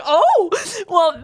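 high, loud retold reaction through laughter; laughing imitation. Sister imitates brother's late realization, laughing. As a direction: blurt it high while laughing.

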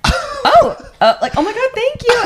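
very high, laughing, stammering thanks; flustered delight. Retold or imitated. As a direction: caught off guard by praise. Flustered fan thanked by the star.

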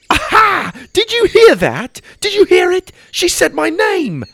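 high, loud, overjoyed cry; thrilled. Acted. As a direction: burst with joy at her first word. Excited boy hearing first word.

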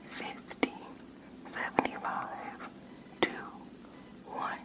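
faint far-off whisper into one ear; distant test whisper; soft whisper. Shown as a demonstration. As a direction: breathe each word softly into the ear. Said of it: "me whispering in your ears like the last one".

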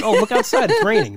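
high, loud and laughing; laughing mock discovery. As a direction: squeal it out in mock surprise.

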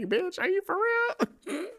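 high, slow squeal of disbelief; bitch, are you serious; incredulous. Pretend. Mock quote in disbelief.

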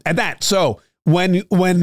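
ordinary talk, nothing marked

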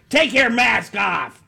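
high, slow mock yell; imitated yelling. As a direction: call it out like a heckler, each word stretched. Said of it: "Can you imagine yelling at those people saying".

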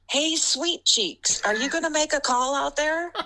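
heckler's call. Retold or imitated. Heckler yelling at the ref.

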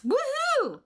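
high, bright cheer of joy; excitable. Real, not acted. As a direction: whoop it out with delight. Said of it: "That was so excitable".

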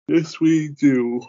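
very slow, high, stretched-out words; drawn-out agreement. Real, not acted. Host winding up the show, agreeing in a long drawl. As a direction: draw each word out long and high. Repeats it drawn out before sign-off.

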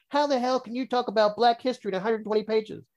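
high-pitched mock disbelief; put-on astonished challenge; incredulous mimicry. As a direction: play the outraged sceptic in a high voice.

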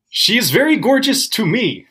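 put-on high squeaky kid voice, pushed hard; meme kid impression. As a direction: squeak it like a bullied kid standing up for himself. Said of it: "My go-tos are".

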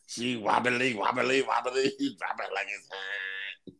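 [name]-like impression. Co-host answering a bad [name] impression, laughing rapper take-off. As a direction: imitate a rap hook, breaking into a laugh. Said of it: "you sound like [name]".

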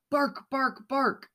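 high toy dog barks; high storybook barks; barking. A narrator's reading. Toys barking in box.

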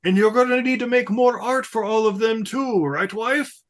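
hammed-up grand announcement; Yamamoto impression. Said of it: "he's like".

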